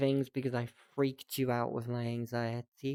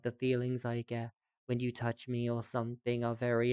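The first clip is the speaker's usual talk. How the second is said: usual speaking voice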